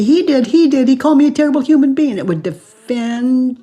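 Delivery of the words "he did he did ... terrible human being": put-on higher, louder, rapid childish protest; the ego protesting. Shown as a demonstration. The ego defending itself, high fast childish whine. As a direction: whine it fast like a kid blaming another. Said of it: "The ego would say".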